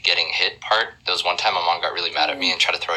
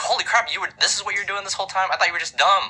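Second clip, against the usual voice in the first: high, astonished mother; amazed mom; 14 semitones higher than usual. Retold or imitated. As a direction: blurt it out in happy shock. Mom amazed at son's work.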